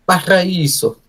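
high, loud hammed-up Spanish accent; terrible Spanish accent. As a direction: belt it like a salsa singer, bad Spanish accent. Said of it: "that was a terrible Spanish accent".

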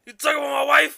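mock outrage. Mock outrage over wife.

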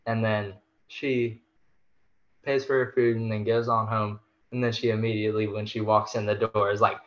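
normal talking voice

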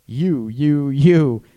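loud, hammered accusation; accusing. Acted. Coach showing how blaming a partner sounds, loud and accusing. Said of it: "they're gonna feel attacked".

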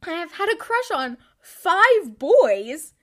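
hammed-up confession of five crushes; dramatic confession. Real, not acted. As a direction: stretch it out like a tragedy.

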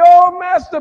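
high, loud acted cry for help; hammed-up panic from the boat; panicked cry. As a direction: call out like a frightened disciple. Acts disciples crying out in storm.

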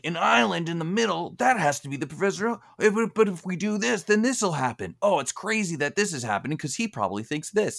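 a hammed-up Brock explaining for the kids; Brock impression. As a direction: rattle off exposition like an eager guide. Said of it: "Brock will be like".

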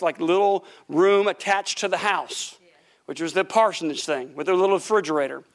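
normal talking voice

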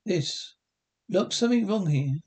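ordinary talk, nothing marked

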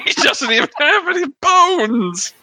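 high squeaky laughter through words; giggling helplessly mid-sentence; laughing. Real, not acted. Laughing fit after the joke.

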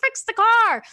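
high, loud, slow demand; frustrated shout. Retold or imitated. A mum stuck with the car shouting for her husband, loud and high. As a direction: yell it up the stairs in frustration. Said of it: "I'm like".

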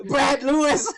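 loud laughing shout of the name. Real, not acted. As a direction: yell the name while laughing. Answering who wrote it.

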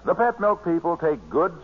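normal talking voice

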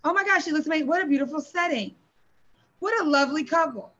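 high, quick gushing compliments; gushing guest. Retold or imitated. Guest praising the wedding, high and quick. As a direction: rattle off praise like a thrilled guest. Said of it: "This is what I'm saying at your wedding".

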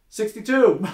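high, loud holler; called-out number. Acted. Joke teller as an inmate calling out a number, shouted. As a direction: shout it down the cell block. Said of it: "more numbers start getting called out".

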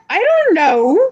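high, slow "I don't know"; mock clueless. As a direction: play dumb, drawn out and high. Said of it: "I'm like".

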